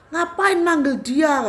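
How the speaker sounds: slow, high, loud angry question; marah. Retold or imitated. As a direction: drag out the spirit's indignant demand. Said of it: "marah, terus dia tuh bilang begini". (menegur dengan marah, lambat dan tinggi)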